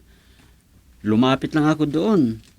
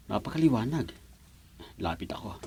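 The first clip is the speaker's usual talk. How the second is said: muttering to himself; 8 semitones lower, 12 dB quieter than usual. Acted. Quiet aside to himself.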